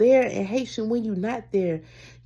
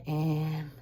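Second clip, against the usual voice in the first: ordinary talk, nothing marked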